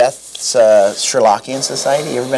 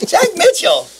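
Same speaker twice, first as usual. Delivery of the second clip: high, loud name call; announcer call; 4 dB louder, slower than usual. Real, not acted. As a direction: call out the guest. Announcing the guest.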